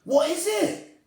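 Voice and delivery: very high, loud cry. Acted. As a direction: shriek the question. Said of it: "the others cried out".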